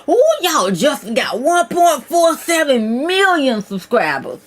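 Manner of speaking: high, excited announcement with drawn-out words; thrilled, high-pitched and stretched out; amazed. Real, not acted. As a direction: marvel loudly at a huge number. Amazed at a subscriber count.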